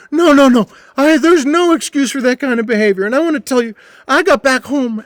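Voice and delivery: put-on stumbling drunk pleading sorry; drunk apology. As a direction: drunk regular begging forgiveness, high and loud. Drunk Butch tells ghost story.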